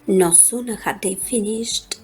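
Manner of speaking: ordinary delivery, nothing marked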